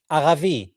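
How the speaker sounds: "Aravi" with throaty r and a v. Shown as a demonstration. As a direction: say "Aravi" with v replacing the Arabic b. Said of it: "B, they say V".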